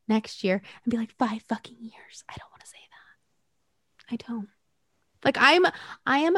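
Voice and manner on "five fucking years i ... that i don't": whispering. Real, not acted. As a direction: whisper it like a dreaded phrase. Dreading saying it again.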